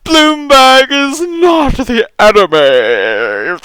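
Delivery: slow, high scold; mock liberal voice. Mocking a commenter defending Bloomberg, slow and prissy. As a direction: plead it in a prissy put-on.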